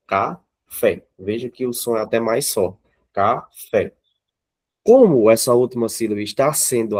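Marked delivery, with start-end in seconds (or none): 0.1-1.1 "Café" in two pieces, a short gap between; separated into syllables. Shown as a demonstration. Teacher showing the class the strong syllable, word split in two. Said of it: "quando você separa café". 3.1-3.9 "Café" in two pieces, a short gap between; separated into syllables. Shown as a demonstration. Teacher showing the class the strong syllable, word split in two. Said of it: "quando você separa café".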